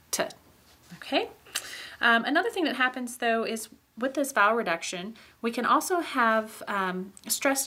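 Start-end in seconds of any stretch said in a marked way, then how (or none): none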